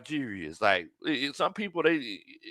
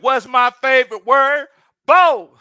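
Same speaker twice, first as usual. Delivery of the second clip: much higher, louder [name] impression; 14 semitones higher, 12 dB louder, slower than usual. Streamer imitating the rapper reading a fan comment, loud. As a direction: ham up the rapper's catchphrase. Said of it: "in his too short voice".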